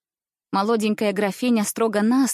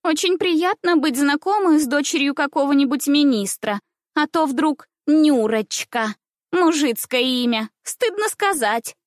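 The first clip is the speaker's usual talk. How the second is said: put-on high, snobbish little girl's voice; disdainful; 6 semitones higher, 3 dB louder than usual. A narrator's reading. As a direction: sneer in a high, affected little voice. Ninochka goes on sneering. (презрительно, высоким голоском)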